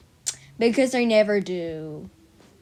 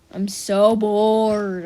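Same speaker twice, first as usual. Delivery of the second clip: "so bored" stretched very slowly and louder; drawn-out bored; 6 dB louder, much slower than usual. Real, not acted. Bored vlogger stuck at home in quarantine, drawn-out groan. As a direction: drag out every word of "I'm so bored".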